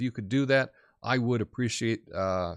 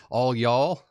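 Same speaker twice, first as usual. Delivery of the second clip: playful Texan "All y'all", louder; Texas drawl; 7 dB louder than usual. Shown as a demonstration. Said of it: "There's y'all and all y'all".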